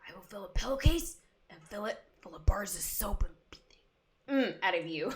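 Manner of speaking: whispered mock threat; hushed, menacing whisper played for laughs; Step Brothers threat. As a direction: whisper a comic movie threat, voiceless. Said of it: "what is the Step Brothers line".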